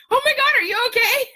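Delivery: high, loud worried cry; alarmed call. Retold or imitated. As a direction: call out in alarm through the door. Retold alarm at partner's scream.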